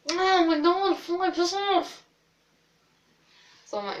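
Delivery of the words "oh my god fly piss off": sudden higher, louder snap at a fly; real irritation breaking into the story; annoyed at a fly. Real, not acted. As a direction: shoo the fly away, irritated. Fly bothering her again.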